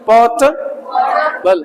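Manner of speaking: slow "पोर्टेबल" as "पोर्ट", pause, "बल"; syllable by syllable. Shown as a demonstration. Teacher drills students on the new word, slowly splitting "पोर्टेबल". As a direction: split "पोर्टेबल" in two with a long first part. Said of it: "भाई पॉटी नहीं है".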